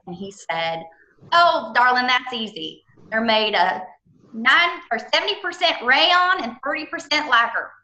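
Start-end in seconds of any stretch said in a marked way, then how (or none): 1.3-7.7 imitating Ken's thick Southern accent, pitched higher. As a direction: do the warehouse man's Southern accent, rising on the numbers. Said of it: "this real thick, wonderful Southern accent".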